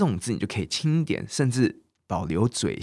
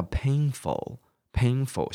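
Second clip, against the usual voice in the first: "painful" drawn out slowly; slow model word; 3 semitones higher, much slower than usual. Shown as a demonstration. Teacher repeats painful slowly for class. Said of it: "所以好痛哦".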